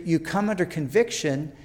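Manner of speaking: ordinary delivery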